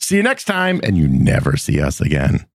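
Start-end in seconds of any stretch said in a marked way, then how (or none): 0.0-0.8 cheery sign-off. Pretend. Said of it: "It'll just be".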